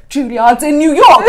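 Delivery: high, laughing mock of mum's reply; mocking mum's pointed remark; imitating mum. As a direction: mum stating the obvious, laughing. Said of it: "My mum was like".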